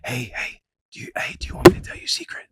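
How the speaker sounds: fast hushed whisper. Retold or imitated. Calming a toddler who is spazzing out, fast whisper. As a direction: coax her with a quick whisper. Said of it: "like whispering to them".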